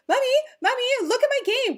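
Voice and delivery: put-on high, eager little boy calling his mom; child's voice. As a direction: squeak it out like a six-year-old pestering mom. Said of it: "and he's like".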